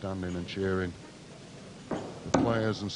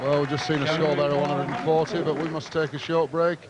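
announcer; 7 semitones higher, 6 dB louder than usual. Real, not acted. Commentator goes to break.

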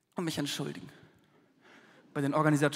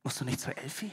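soft, low, gentle ask; soft question; 5 semitones lower, 9 dB quieter than usual. Retold or imitated. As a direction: ask it quietly, almost tender. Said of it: "nahm meine Hand und fragte".

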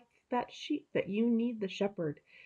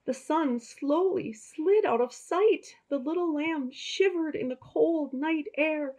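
higher, storybook narration; slow, higher storyteller voice; reading to children; 8 semitones higher, 4 dB louder than usual. A narrator's reading. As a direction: tell it like a bedtime story to kids.

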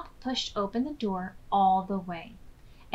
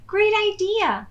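delighted; 11 semitones higher, 6 dB louder than usual. Acted. Hip delighted under the sheet.

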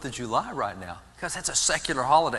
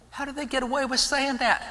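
pitched way up in disbelief, rising high on away; incredulous question; 11 semitones higher than usual. Real, not acted. Preacher asking the crowd in high, incredulous disbelief. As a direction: ask it as if it's outrageous. Indignant rhetorical question to audience.

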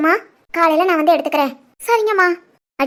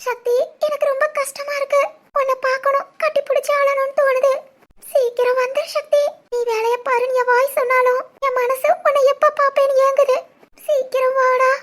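tearful longing; 4 semitones higher than usual. Acted. Wife missing her husband after the call, weeping. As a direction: plead through tears for him to come.

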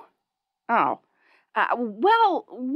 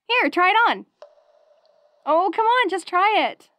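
bubbly; 6 semitones higher than usual. Acted. Coaxing to try wolf hat.

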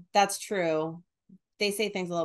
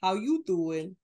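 slow, drawn-out New Jersey greeting; mock New Jersey come-on; New Jersey accent. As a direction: stretch it like a New Jersey local. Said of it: "you know, New Jer".

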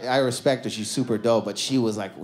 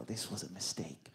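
quiet dread; 9 semitones lower, 20 dB quieter than usual. Retold or imitated. Dread on seeing girlfriend watching.

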